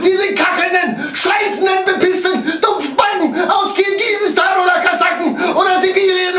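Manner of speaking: screamed high and loud, raging at the attackers; furious rant. Acted. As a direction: spit out pure rage at full volume. Rage outburst while fleeing attackers.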